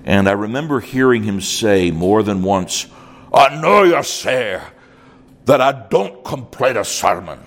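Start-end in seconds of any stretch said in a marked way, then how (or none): 3.3-7.4 Irish brogue. Retold or imitated. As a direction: imitate an old Irish preacher's brogue. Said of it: "So without the accent".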